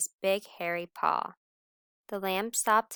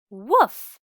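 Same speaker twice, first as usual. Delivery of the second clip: loud playful woof; 5 semitones higher, 14 dB louder than usual. Acted. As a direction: bark out a cheeky woof. Lamb imitates a dog.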